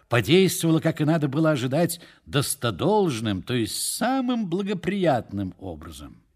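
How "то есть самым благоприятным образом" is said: ordinary delivery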